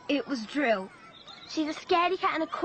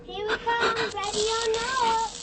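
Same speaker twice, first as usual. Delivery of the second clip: sing-song taunt; 6 semitones higher than usual. Acted. Child playing hide-and-seek menacingly.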